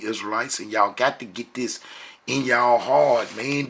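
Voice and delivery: usual speaking voice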